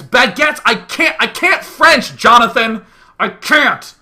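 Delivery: loud, high, exasperated refusal; comic indignant yelling; mock outburst. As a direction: blow up in hammed-up exasperation. Acted outburst at Jonathan.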